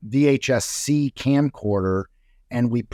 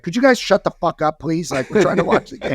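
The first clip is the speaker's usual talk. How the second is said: annoyed fan; 6 semitones higher than usual. Retold or imitated. Annoyed fan behind them.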